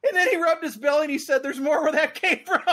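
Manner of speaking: very high voice, cracking up; helpless laughter. Real, not acted. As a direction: struggle through the punchline while laughing. Teller cracks up at punchline.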